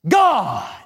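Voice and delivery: God punched high and declared; emphatic God. Shown as a demonstration. Preacher answering why with one proclaimed God. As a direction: ring out the word God as the answer. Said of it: "I say it like that".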